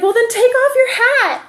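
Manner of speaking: high, loud imitation of a boy hollering; mock shout from a distant kid; child calling out. Five-year-old telling his mother across the creek bed, calling out.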